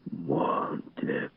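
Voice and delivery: soft whispered warning; hushed, voiceless call; Faber. A narrator's reading. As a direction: hiss the name into an ear. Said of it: "Голос Фабера ввинчивался Монтегу".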